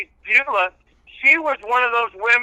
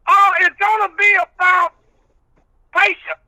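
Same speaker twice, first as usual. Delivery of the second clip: country preacher character; 8 semitones higher than usual. Acted. Old reverend preaching patience to the radio host, in full preacher voice.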